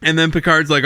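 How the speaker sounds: normal talking voice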